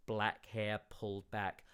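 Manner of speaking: ordinary delivery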